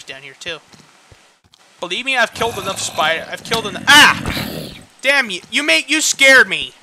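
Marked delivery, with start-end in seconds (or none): 3.9-4.7 sudden high loud yelp; startled scream. Real, not acted. Startled by spider. 5.0-6.7 high, loud startled outburst; shaken and angry. Real, not acted. Just startled.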